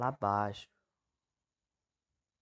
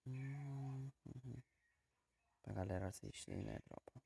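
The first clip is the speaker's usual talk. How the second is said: speaking softly; 8 dB quieter than usual. Real, not acted. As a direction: keep it down for sleepers. Keeping quiet for sleepers.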